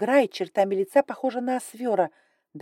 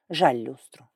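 sighing; 5 semitones lower, 3 dB louder, slower than usual. Acted. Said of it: "вздохнул Фроуд".

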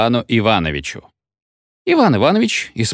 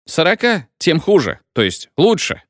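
a lightly voiced tipsy feldsher, higher; lightly tipsy doctor, higher pitch; drunk feldsher correcting himself; 7 semitones higher than usual. A narrator's reading. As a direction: toss off the slip like a drunk speaker. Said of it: "продолжал оратор".

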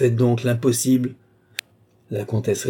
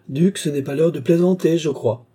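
raised, louder note of rebuke; reproving; 6 semitones higher, 4 dB louder than usual. Acted. Countess chides the duke for joking, reproving.

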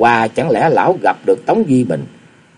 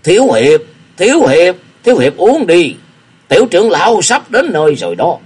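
put-on a raised, pressing old beggar urging the young hero to drink; old man pressing a cup of wine on the young hero, pushing; 6 semitones higher, 4 dB louder than usual. A narrator's reading. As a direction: hurry him along, a touch raised. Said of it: "đưa cho Ngô Cương dục".

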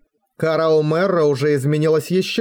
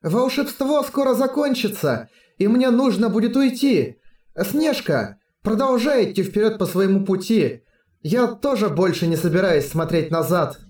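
put-on gentle, higher girlish voice; soft high girl voice; 5 semitones higher than usual. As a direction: softly say goodbye, high and tender. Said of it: "мягко улыбнулась и продолжила".

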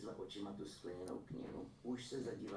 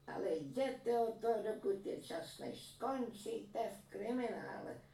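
higher, louder warning; warning old woman; 7 dB louder, slower than usual. A narrator's reading. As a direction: send the swindler away while he can. (vyšší hlasité varování stařenky)

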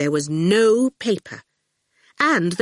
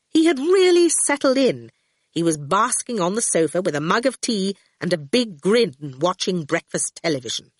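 plain reading voice

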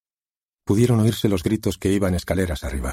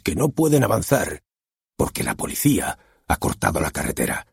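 lightly voiced director; 2 semitones lower than usual. Director conjures the opening scene for the author, lightly voiced. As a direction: paint the scene, drawing him in.

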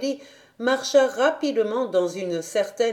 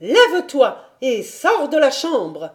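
higher, loud, forceful dismissal; indignant order; 5 semitones higher, 5 dB louder than usual. A narrator's reading. Governess orders Heidi from the room, indignant. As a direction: order her out, punching the words. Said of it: "elle put articuler".

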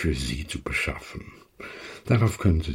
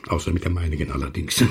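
ordinary talk

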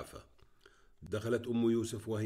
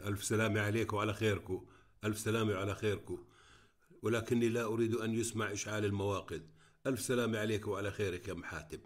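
ordinary delivery, nothing marked

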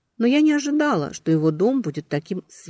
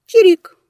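squeaky chirp; 14 dB louder than usual. Acted. Alarm bird keeps chirping.

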